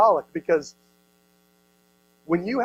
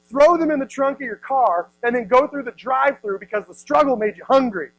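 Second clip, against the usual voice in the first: usual speaking voice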